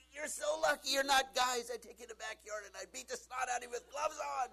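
loud, high hammed-up threat to his daughters; mock angry dad. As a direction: bellow at the girls like a furious dad. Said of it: "I told her".

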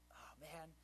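whispered letdown; a mock disappointed whisper. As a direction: breathe it out like a quiet sigh of defeat. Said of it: "I'm like".

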